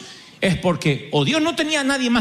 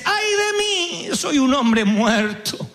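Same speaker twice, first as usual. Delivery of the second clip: slow, wailing lament; Isaiah lamenting; 6 semitones higher, much slower than usual. Retold or imitated. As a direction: mourn like a doomed man. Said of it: "Isaías dice". (Isaías lamentándose, lento)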